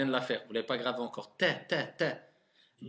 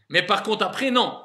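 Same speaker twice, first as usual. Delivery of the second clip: "après, non" said high and loud; emphatic no after; 4 semitones higher, 6 dB louder than usual. Shown as a demonstration. Teacher insisting to class, louder.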